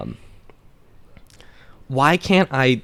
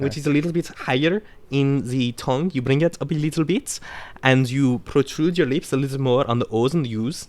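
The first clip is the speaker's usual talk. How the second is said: Swedish accent. Shown as a demonstration. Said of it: "that one is actually Swedish".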